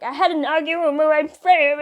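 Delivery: high, loud, garbled mock crying babble; imitating upset stuttering. Crying girl explaining to classmate, mock upset babble. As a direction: blurt it out, imitating tearful stammering. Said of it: "I just stuttered and everything".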